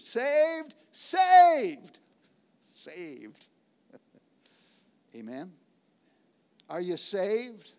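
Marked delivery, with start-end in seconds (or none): 0.0-2.0 Saved shouted much higher and louder; rising shouted Saved; exultant shout. Acted. Preacher exults over salvation. 2.7-5.5 Saved much softer and lower; quiet awed Saved; hushed. Acted. Preacher drops to hush after shout.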